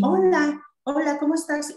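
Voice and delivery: saludo agudo y alegre a un niño; adulto saludando. Shown as a demonstration. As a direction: saluda al niño con voz más aguda y cantarina. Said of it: "el niño la repite". (saludo agudo de terapeuta a un niño)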